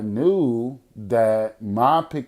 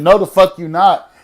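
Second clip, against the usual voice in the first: higher, louder tough refusal; 6 semitones higher, 10 dB louder than usual. Retold or imitated. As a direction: bark it back, macho and loud. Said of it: "feeling like the trade".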